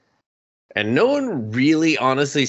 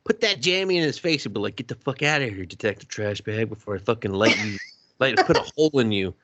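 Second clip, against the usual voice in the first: fast tough talk; faster than usual. Retold or imitated. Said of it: "I was just like".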